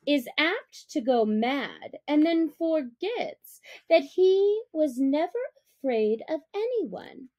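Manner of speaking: usual speaking voice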